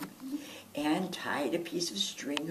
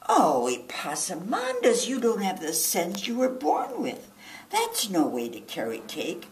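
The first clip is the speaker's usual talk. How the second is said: exasperated scolding; 5 dB louder than usual. Acted. Mama scolding her foolish son, loud and exasperated. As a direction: scold him like a fed-up mama.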